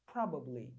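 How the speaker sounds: teacher reads probably from the phonology; model pronunciation. Shown as a demonstration. Teacher reads the phonology for students, low falling. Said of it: "Olha aqui do ladinho a fonologia".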